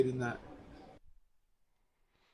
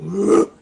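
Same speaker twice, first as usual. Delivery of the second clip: loud, higher imitated gasp from the Beholder; imitated creature noise; 6 semitones higher, 13 dB louder than usual. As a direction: make the monster's noise, loud and sudden. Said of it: "It makes a bit of".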